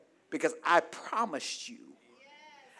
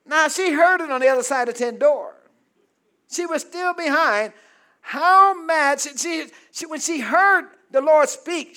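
loud, high preacher's narration; full-force preaching; 11 semitones higher, 12 dB louder than usual. Acted. Preacher telling how Sarah overheard, full-force preaching. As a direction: preach it with raised voice.

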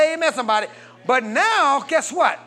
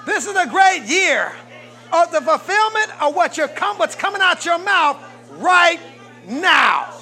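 getting louder; 8 semitones higher, 5 dB louder than usual. Real, not acted. Preacher builds to shouting praise.

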